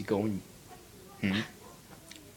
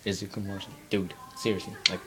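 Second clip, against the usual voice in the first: normal talking voice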